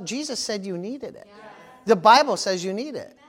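ordinary talk

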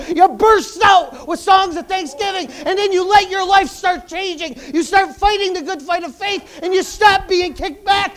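loud, high, relentless revival preaching; full-force preaching. Real, not acted. Preacher urging Christians to burst with thanksgiving, shouted preaching. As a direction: build it up and let it burst out.

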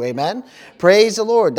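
usual speaking voice

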